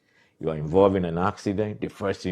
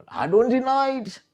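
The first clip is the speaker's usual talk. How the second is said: much higher, mocking voice of the brother; mocking quote; 7 dB louder than usual. Mocking a brother who now admits the profiling, squeaky mock voice. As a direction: parrot his claim in a squeaky mock voice. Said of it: "he's saying".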